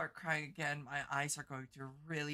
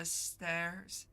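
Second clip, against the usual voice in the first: normal talking voice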